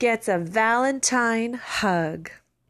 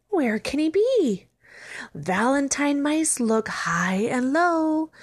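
plain reading voice

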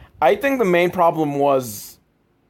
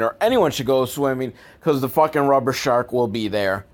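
ordinary talk

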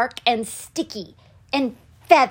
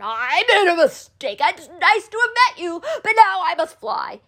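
high, rushed, panicky excuses; spluttering; 7 semitones higher, faster than usual. A narrator's reading. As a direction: gabble it as the frightened dragon backing away. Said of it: "he spluttered".

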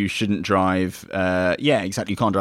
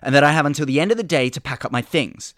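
excited customer; 8 semitones higher, 4 dB louder than usual. Acted. Customer rattles demands in sketch.

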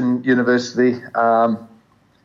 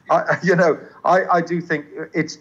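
ordinary delivery

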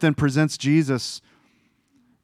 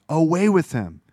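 a first, somewhat raised crowd chant; mock crowd cry; 4 semitones higher, 3 dB louder than usual. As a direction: start the mob's demand. Crowd demands crucifixion, first cry.

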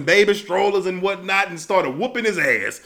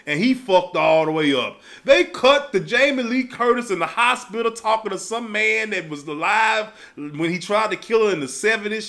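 ordinary talk

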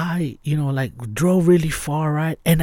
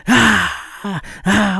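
panicked yelling, pitched up; retold shouting; 6 semitones higher, 5 dB louder, slower than usual. Driver panicking at the leg above the car, yelling. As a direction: yell it out in a panic. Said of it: "I was shouting".

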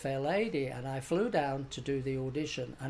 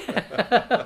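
real laugh; 7 semitones higher, 6 dB louder than usual. Real, not acted. Laugh after the story beat.

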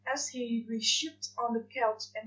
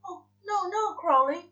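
higher, flustered protest; flustered angel; 7 semitones higher, 4 dB louder than usual. Acted. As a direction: hurry to correct him, higher. Aziraphale protests.

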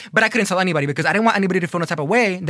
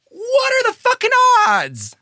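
incredulous squeal; 3 dB louder than usual. Real, not acted. Marvelling at the coincidence.

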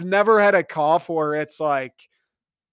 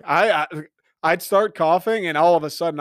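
ordinary talk

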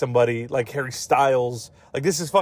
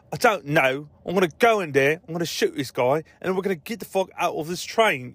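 imitating an English movie accent; 3 semitones higher than usual. YouTuber quoting the film's twin on the train, English accent. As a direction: put on a British film-gangster accent. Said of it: "like, an English accent".

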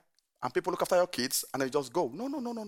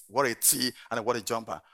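ordinary talk

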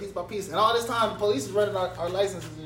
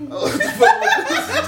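laughing; 5 dB louder, slower than usual. Real, not acted. Bursting into laughter at gum story.

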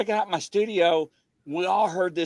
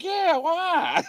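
clueless guy; 13 semitones higher than usual. Retold or imitated. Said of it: "the guy's like".

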